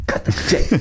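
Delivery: voiceless hiss at a colleague; hissed whisper. Acted. Broadcaster sniping at his partner during a silence, whispered. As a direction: whisper it as a secret warning.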